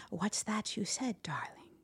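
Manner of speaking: soft, tender spider voice; gentle. A narrator's reading. Spider coaxing frightened Miss Muffet, gently. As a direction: ask it softly and sweetly. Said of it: "the sweet spider said gently".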